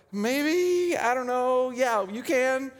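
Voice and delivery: high-pitched, drawn-out mock doubt; hesitant crowd. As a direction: imitate a crowd warily giving in. Acting crowd unsure, half agreeing.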